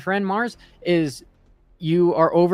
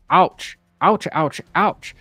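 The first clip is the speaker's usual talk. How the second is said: pained ouch; 3 semitones higher, 5 dB louder, much slower than usual. Acted. Chart shows a big drop.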